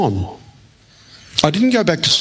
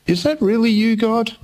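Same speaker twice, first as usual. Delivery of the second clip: high, tentative imitated doubt; doubtful questioning; 9 semitones higher than usual. Preacher voices doubters sitting in the room, mock hesitant. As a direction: wonder upward, pitched well above usual.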